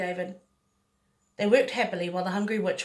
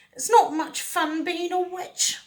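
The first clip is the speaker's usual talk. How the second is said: mumbled; 9 semitones higher, slower than usual. A narrator's reading. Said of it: "she mumbled".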